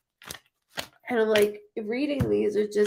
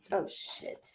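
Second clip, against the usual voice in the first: breathy under-the-breath oh shit; whispered curse; 13 dB quieter than usual. Real, not acted. Fumbling the Uno cards on stream, whispered curse. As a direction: mutter it to yourself, unvoiced. Muttered aside while shuffling cards.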